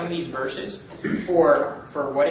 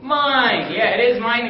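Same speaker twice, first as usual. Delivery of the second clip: emphatic; 5 semitones higher, 6 dB louder, slower than usual. Real, not acted. Emphatic answer to the class.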